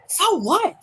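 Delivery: high, rising So what; incredulous echo. Retold or imitated. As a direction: throw it back in disbelief. Mocking the quoted line.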